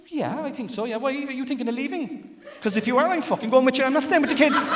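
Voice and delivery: husband frantically begging to come along; panicked blurt. Retold or imitated.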